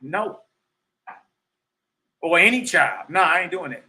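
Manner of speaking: firm, loud no with no hesitation; emphatic refusal. Retold or imitated. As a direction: shut the question down hard. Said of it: "it should be an emphatic".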